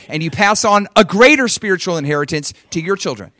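力強い説教、高く大きな声; preaching at full force. Real, not acted. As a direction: preach it high and loud, driving the point home.

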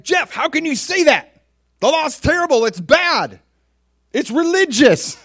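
high, loud mock indignation; hammed-up, shrieky horror at heresy; outraged objector. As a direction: cry out in scandalized protest.

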